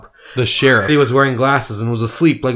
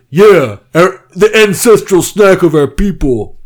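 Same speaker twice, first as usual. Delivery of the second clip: higher, louder mock-solemn voice; mock-reverent furry; 7 semitones higher, 6 dB louder than usual. Furry hailing Cheetos, mock-solemn. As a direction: hail the snack.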